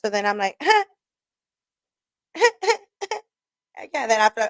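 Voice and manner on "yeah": awkward laugh. Retold or imitated. Awkward reply to cousin's intro.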